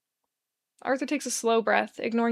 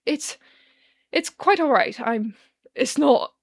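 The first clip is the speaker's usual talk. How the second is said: higher, louder, slow tearful stammer; weeping; 3 semitones higher, 5 dB louder, slower than usual. Acted. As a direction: choke the words out through tears. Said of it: "the man weeps".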